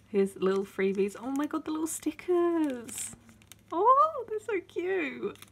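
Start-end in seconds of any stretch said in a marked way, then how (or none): 1.2-3.2 high thrilled whisper; whispered joy over stickers; excited whisper. Real, not acted. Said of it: "I got a little bit too excited". 3.7-5.4 very high squeal, slowing; real overexcited squeal over stickers; too excited. Real, not acted. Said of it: "I got a little bit too excited".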